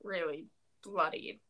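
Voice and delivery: ordinary talk, nothing marked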